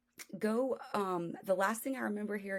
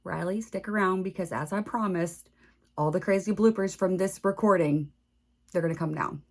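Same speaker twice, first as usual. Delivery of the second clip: loud, upbeat host sign-off; podcast outro; 7 dB louder than usual. Acted. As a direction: wrap up the show like a host.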